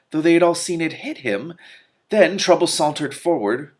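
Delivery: ordinary delivery, nothing marked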